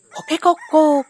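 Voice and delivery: high, loud cock-a-doodle-doo; a storyteller's cheerful rooster call; rooster crow. Acted. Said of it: "鶏が鳴きました".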